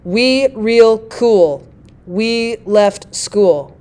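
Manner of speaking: very slow spondees, equal weight on "We", "real", "cool", "We", "left", "school"; all stressed. Shown as a demonstration. Teacher demonstrating the spondee with an example, every word stressed. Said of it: "a foot composed of nothing but stressed symbols".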